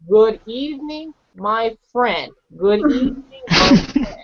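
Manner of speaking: usual speaking voice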